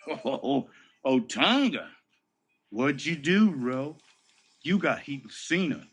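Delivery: a hint of a coworker gleefully ribbing him; teasing coworker. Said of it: "from Heath Slater again".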